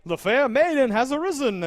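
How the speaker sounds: high, loud mock-noble proclamation; mock-regal quote. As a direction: lift it up like a pompous courtier announcing. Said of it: "he's like".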